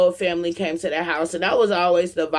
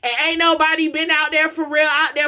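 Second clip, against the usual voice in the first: raised, urgent preaching voice; 5 semitones higher than usual. Acted. Preacher urging parents to hear their kids, raised and urgent. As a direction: preach it higher to wake the crowd up. Preaching to the crowd about healing.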